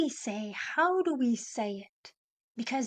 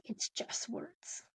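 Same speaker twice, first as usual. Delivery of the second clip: hushed whisper; 12 dB quieter, much slower than usual. Real, not acted. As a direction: drop to a whisper on the last words. Dramatic close on key point.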